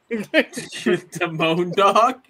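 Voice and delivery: stretched-out laughing echo. Real, not acted. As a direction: laugh while repeating the name slowly.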